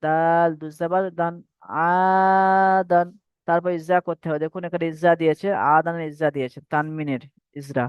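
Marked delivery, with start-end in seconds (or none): 1.6-3.1 slow, long-held chanted reading of the word; drawn-out recitation. Shown as a demonstration. Teacher chanting a word slowly for students. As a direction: stretch each syllable like Quran recitation. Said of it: "এটা প্রথম দেখুন".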